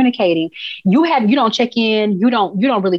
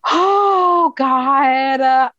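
exasperated oh God; 4 semitones higher than usual. Real, not acted. Reacting to his outburst.

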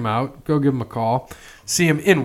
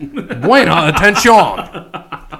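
laughing; 7 semitones higher, 8 dB louder, much slower than usual. Real, not acted. Mock Spanish reading.

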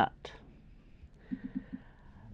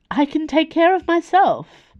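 indignant protest; 13 semitones higher, 5 dB louder than usual. Acted. Dio insists despite his pain.

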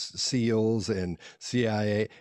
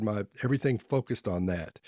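ordinary delivery

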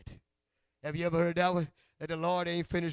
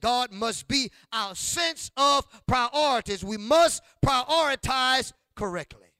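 loud, high, slow sung preaching; full-force preaching; 8 semitones higher, 11 dB louder, slower than usual. Acted. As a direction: build to a chanted shout. Preacher peaks on God first.

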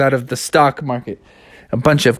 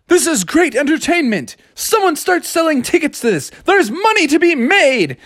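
high-pitched eager scheming; thrilled traders; 12 semitones higher than usual. Acted. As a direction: urge everyone to cash in. Excited traders spot money.

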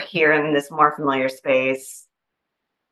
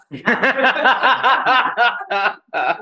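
laughter; 7 semitones higher than usual. Real, not acted. Laughing at the cliche.

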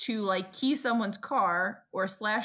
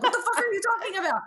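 high, loud and fast disbelief; baffled outsider; 12 semitones higher, 5 dB louder, much faster than usual. Retold or imitated. Idaho friend baffled by the Johnny Pump, mock disbelief. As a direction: blurt it in total confusion. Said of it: "They're like".

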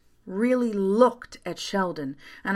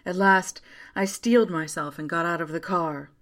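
usual speaking voice

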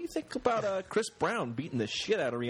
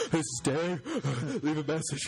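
mock breathless voicemail greeting; out of breath; 4 semitones higher than usual. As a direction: gasp between phrases, imitating a winded message. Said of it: "He sounds like he's out of breath".

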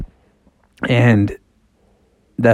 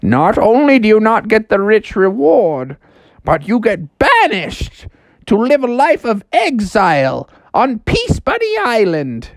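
high, swooping theatrical pronouncement; talking house; 8 semitones higher than usual. Acted. House threatens Froggy with exile, high and theatrical. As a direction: pronounce the banishment with hammy menace. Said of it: "And he's like".

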